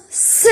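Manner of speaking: "S" as a phonic "sss-uh"; sound of S. Shown as a demonstration. Teacher repeats the sound T makes here, "S" as "suh". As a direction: give "S" its sound "suh", not the letter name. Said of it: "Fait le son".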